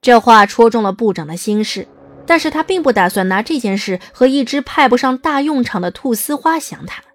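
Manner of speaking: plain reading voice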